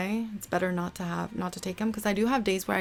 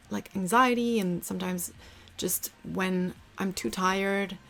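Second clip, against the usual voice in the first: usual speaking voice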